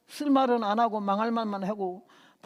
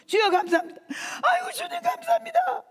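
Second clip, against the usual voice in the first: crying out thanks, much higher and louder; tearful thanks; 5 dB louder than usual. Retold or imitated. Preacher reliving her thanks to Jesus, crying out. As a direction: sob out gratitude to the Lord. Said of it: "그래서".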